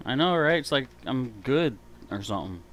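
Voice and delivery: ordinary delivery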